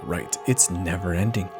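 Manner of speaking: usual speaking voice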